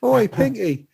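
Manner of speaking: high Cockney "Oi, Pinky"; Cockney accent. Retold or imitated. Answering the praise with another Cockney call. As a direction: throw it out in Cockney. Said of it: "with a Cockney accent".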